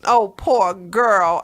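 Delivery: ordinary talk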